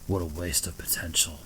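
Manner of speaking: low whispered brooding voice; Shadow voice. Pretend. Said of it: "That's my Shadow voice".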